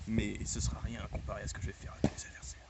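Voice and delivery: fast, hushed villain whisper; sinister wrestling-promo whisper; menacing whisper. Acted. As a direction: whisper the threat to the crowd.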